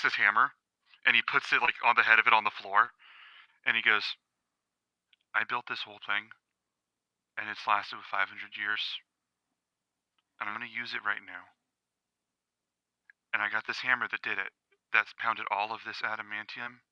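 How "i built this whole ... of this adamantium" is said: very soft, measured in-character voice; quiet menace. Acted. Smith with hammer facing down a dog, quietly menacing. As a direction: let each phrase land softly, with long pauses. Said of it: "he says it real quiet".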